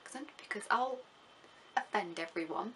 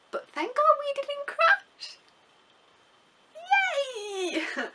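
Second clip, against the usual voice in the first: much higher, slow, squealing relief; relieved cheer; 5 dB louder, much slower than usual. Real, not acted. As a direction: cheer like you just survived the flight. Relief after scary flight.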